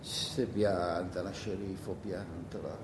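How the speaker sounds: quiet, weary rebuke to his own rambling; self-scolding. Acted. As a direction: tell yourself off under your breath, slowly.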